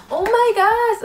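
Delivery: high, loud gasp of delight; shrieking excitement. Retold or imitated. Fan spotting [name], shrieking with delight. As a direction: shriek it at the sight of him. Seeing her favourite idol.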